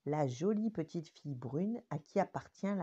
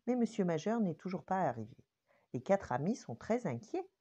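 usual speaking voice

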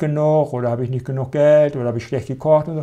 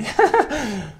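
short high laugh; 10 semitones higher than usual. Real, not acted. Laughs at own example.

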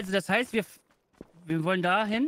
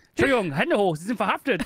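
police officer; 3 semitones lower, 4 dB louder than usual. Pretend. Mock arrest in a museum.

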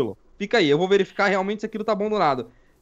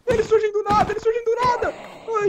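high-pitched, frantic repetition; panicked complaint; 12 semitones higher than usual. Real, not acted. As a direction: squeal about the ambush. Enemies spawning around speaker. (voz aguda, em pânico)

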